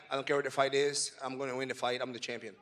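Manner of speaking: ordinary delivery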